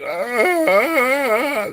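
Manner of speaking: long high moo of distressed cows; drawn-out high cattle wail; imitated cow bellow. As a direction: bellow like a restless herd, high and loud. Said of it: "and they were like".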